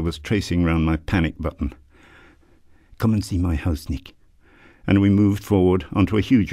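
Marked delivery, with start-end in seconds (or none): 3.0-4.1 gentle Irish brogue. A narrator's reading. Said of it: "the gentlest Irish brogue".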